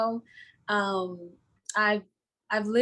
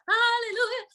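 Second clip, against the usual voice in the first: church hallelujah; 13 semitones higher than usual. Retold or imitated. Church voice recalled.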